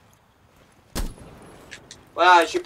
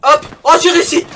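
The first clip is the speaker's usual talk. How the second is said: high, loud cry of triumph; excited shout; 9 semitones higher, 9 dB louder than usual. Real, not acted. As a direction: yell it out in victory. Triumphant cry after success.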